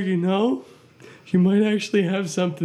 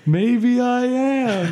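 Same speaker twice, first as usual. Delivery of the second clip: dramatic; 5 semitones higher, much slower than usual. Acted. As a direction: lean into the drama. Repeats his dramatic catchphrase.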